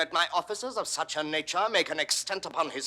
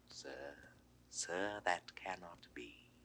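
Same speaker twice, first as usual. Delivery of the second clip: low, hushed disbelief; near-whispered dangerous calm; menacing quiet; 7 semitones lower, 14 dB quieter than usual. Acted. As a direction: speak very softly, with pauses, as a threat. Ruler coldly doubting a courtier.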